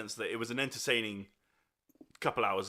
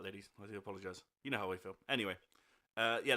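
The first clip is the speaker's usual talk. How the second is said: ordinary talk, nothing marked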